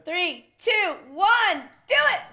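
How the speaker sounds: rising hyped countdown; loud countdown. Real, not acted. Countdown to shotgun beer.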